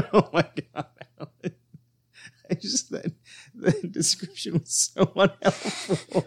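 high voice breaking up with real laughter; laughing helplessly. Real, not acted. Co-host cracking up at a useless description, high, laughing hard. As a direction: struggle to get the words out through laughter.

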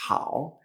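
hao with a gentle breathed h; soft J. Shown as a demonstration. Teacher reminding students of the soft J, hao lightly breathed. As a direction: start hao on a light J, no scraping. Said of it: "es una J suave".

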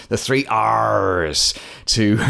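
slow, growling pirate drawl on the three R's. Pretend. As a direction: say it like a hammy pirate. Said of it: "I could say it like a pirate".

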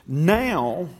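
"Now" drawn out with a rise and fall; emphasis on NOW. Real, not acted. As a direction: lean hard on "Now", then pause. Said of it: "put emphasis on the words".

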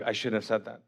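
soft, low-pitched self-reproach; sheepish regret. Acted. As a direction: mumble it to yourself, embarrassed. Acting Nathanael's embarrassed regret.

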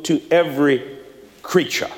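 usual speaking voice

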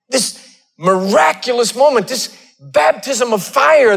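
building sermon cadence, rising and pushing; preaching. Acted. Pastor preaching to his congregation, rising fervor. Preacher telling Elijah story.

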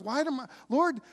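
mildly distressed questioning, halting and restarting; imitated anxious struggle. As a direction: act out a nervous, confused prayer. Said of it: "I think he's struggling. I think he's nervous. I think he's scared".

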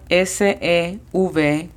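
letters spaced out for learners; spelled slowly for a beginner class. Shown as a demonstration. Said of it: "La pronunciación fonética es".

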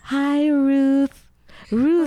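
high, drawn-out happy hello; excited greeting. Real, not acted. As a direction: call out a delighted welcome. Greeting the guest as she returns.

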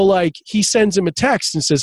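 ordinary talk, nothing marked

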